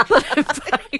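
laughing through the words. Real, not acted. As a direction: giggle as you say it. Laughing while correcting herself.

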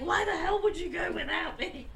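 high, loud mock-angry mum through laughter; mock scolding mum.

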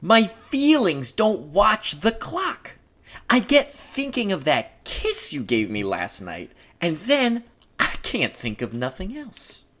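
lightly voiced smitten tough guy; a hint of an old-time sweet-talker; amorous Floyd. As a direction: play a lovestruck cop, lightly put on.

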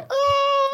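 high piercing mock scream; imitated scream. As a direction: let out a mock dying scream. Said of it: "han skriker i toaletten, bara".

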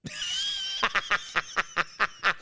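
helpless high-pitched laughter; laughing fit. Real, not acted. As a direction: burst out in a long squealing laugh. Laughs at own innuendo.

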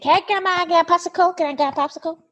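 imitating a thrilled toddler begging grandma; excited toddler. Mother voices excited toddler.

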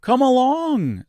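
high, drawn-out hearty call. A narrator's reading. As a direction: sing out a hearty invitation, stretched long. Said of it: "said the Scarecrow heartily".

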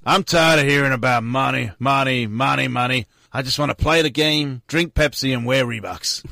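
put-on easygoing American accent at ordinary pitch; relaxed American accent. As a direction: do the American accent without getting wound up. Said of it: "that wasn't so mental".

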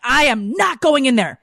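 high, emphatic retold refusal; indignant refusal. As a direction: refuse point-blank, acting out exasperation. Retelling her refusal to go in.

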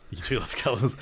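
ordinary talk

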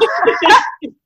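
high teary laugh; a laugh breaking toward a cry. Real, not acted. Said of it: "So sad".

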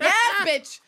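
high, loud, emphatic comeback; mock yell. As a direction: belt out an eager answer. Answers people at the mango stand.

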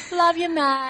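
high, loud ocker "Love you, mate."; sling it like a true-blue Aussie; broad Aussie accent. Retold or imitated. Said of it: "not as romantic when I reply".

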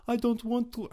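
put-on accidental French accent. Podcaster mimicking people who won't ruin the moment, hammed-up French accent. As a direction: put on a silly, high-pitched French voice. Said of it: "I went with that accent".

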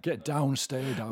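put-on northern English hard-man accent. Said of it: "more north than that".

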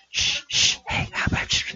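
breathy retold shushing; imitating a hurried whisper. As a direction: hush them like kids sneaking around. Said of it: "all this whispering going".

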